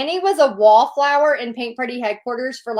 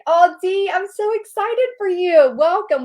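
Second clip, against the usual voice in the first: higher, louder gushing welcome; excited welcome; 7 semitones higher, 4 dB louder than usual. Real, not acted. Host welcomes Dee who just joined, gushing. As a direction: gush over the newcomer. Greeting a new member live.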